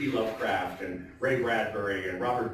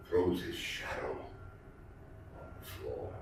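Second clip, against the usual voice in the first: fading to a whisper; 6 semitones lower, 8 dB quieter than usual. Acted. Host ending The Raven for the audience, fading whisper. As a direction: lower and softer, ending voiceless.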